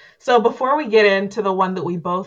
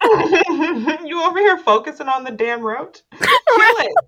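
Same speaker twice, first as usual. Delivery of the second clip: yelling kill it; 7 semitones higher than usual. Real, not acted. Laughing at the TV roach, yelling at them to kill it. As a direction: shout at the screen to squash it.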